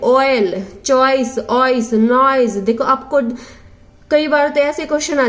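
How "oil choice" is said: usual speaking voice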